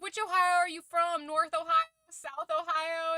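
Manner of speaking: high, loud imitation of keen questioners; mock eager questioning. Imitating Brits quizzing an American about Ohio, eager questioning.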